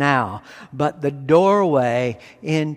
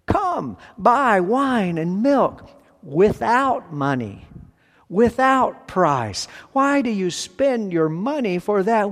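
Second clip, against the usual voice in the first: a lightly raised, higher prophetic reading voice; scripture reading; 3 semitones higher than usual. Acted. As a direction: proclaim the prophet's invitation, a touch higher. Reads Isaiah aloud.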